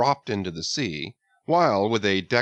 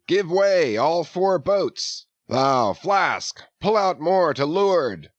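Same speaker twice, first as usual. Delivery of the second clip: higher, louder captain's shout; bellowed orders; 8 semitones higher, 4 dB louder, slower than usual. A narrator's reading. Ahab shouting orders to all four boats, loud. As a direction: bark orders across the water.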